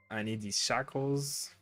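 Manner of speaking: normal talking voice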